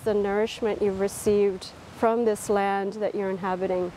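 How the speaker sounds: unhurried, calm body-scan voice; guided meditation. Real, not acted. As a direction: guide them gently through a body scan, leaving space between phrases.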